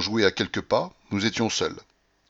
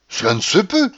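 high, raised exclamation of disbelief; indignant cry; 9 semitones higher, 4 dB louder than usual. A narrator's reading. Petit Français disputes the narrator's tale, crying out. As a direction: cry out in protest. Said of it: "s'écria le petit Français".

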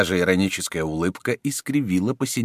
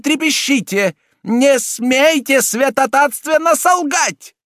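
high, loud furious cardinal; angry shouting; 8 dB louder than usual. A narrator's reading. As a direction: shout much higher and louder in rage. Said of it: "гневно кричал прелат".

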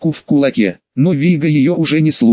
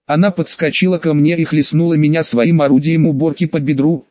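usual speaking voice

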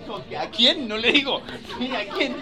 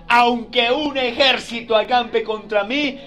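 slow, louder confident recitation; confident declaration; 3 semitones higher, 7 dB louder, slower than usual. Shown as a demonstration. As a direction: say it like you trust it. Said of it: "usted puede decir confiado".

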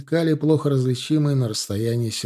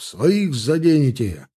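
shouted warning; 3 semitones higher, 4 dB louder, slower than usual. A narrator's reading. Salov warning they'll hit their own, shouted. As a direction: call it out urgently, stretched. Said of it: "крикнул Салов".

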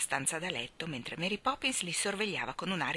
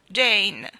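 whining; 4 semitones higher, 10 dB louder than usual. A narrator's reading. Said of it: "piagnucolò".

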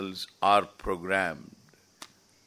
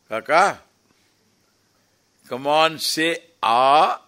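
higher, louder urging lilt; coaxing a crow; 6 semitones higher, 9 dB louder than usual. Pretend. Teacher pretending to ask a crow to speak, coaxing. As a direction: egg the bird on to speak. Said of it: "You ask a kaka".

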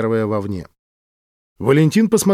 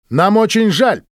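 offended outcry; shouted; 8 semitones higher, 4 dB louder than usual. A narrator's reading. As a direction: cry out, a bit higher and louder. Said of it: "выкрикнула Ксения".

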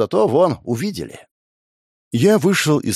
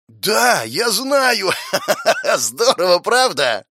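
high laughing boast with a shrill cackle; gloat, laughing at him; gleeful gloating; 9 semitones higher, slower than usual. Acted.

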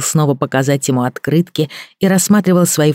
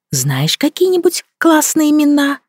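high coaxing voice; 13 semitones higher than usual. A narrator's reading. Julia coaxing the little boy.

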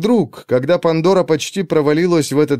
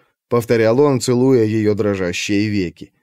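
usual speaking voice

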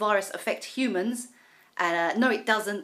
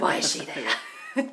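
exasperated grumble; 8 semitones lower, slower than usual. Retold or imitated. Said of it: "He's like".

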